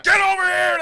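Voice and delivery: full-force, high-pitched drill instructor scream; retold boot-camp yell at the top of the voice. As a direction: bellow an order at new recruits. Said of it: "everybody's yelling and screaming".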